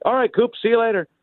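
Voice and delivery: high-pitched imitation of a casual goodbye; breezy farewell. Broadcaster saying bye to his visitor, imitated high and breezy. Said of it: "and he's like".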